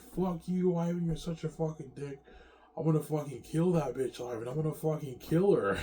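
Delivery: high-pitched acted cursing; angry retold threats. Jealous spirit wife cursing Ivan after his date, angry. Acting out V J's furious threats.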